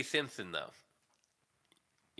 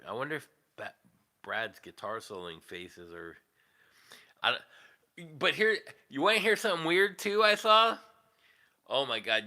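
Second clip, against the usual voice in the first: ordinary delivery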